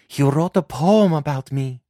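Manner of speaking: lightly voiced young daughter, proud; proud young girl. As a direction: show off the poem like a girl in love. Daughter shows her poem.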